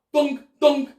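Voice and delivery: two punchy loud thunks. Acted. Storyteller to kids, loud thunk effects. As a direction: bounce out the thunks.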